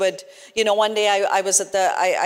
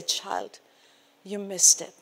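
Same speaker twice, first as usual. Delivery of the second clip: soft, sorrowful near-murmur; hushed gentle rebuke; 4 dB quieter than usual. Retold or imitated. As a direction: quietly voice a tender reproof with long pauses. Voices Holy Spirit's gentle rebuke.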